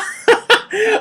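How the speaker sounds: high burst of laughter. Real, not acted. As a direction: crack up at your own joke. Laughs at own joke.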